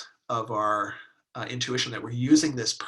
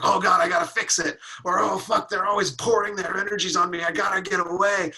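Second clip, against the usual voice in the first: higher, louder mock panic; 7 semitones higher, 4 dB louder than usual. Person triggered by a friend's emotions, in louder mock panic. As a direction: fret like you must flee them.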